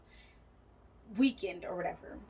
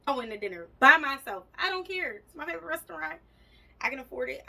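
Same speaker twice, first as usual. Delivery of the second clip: defiant sass; 6 semitones higher, 9 dB louder, faster than usual. Real, not acted. Defiant about dining alone.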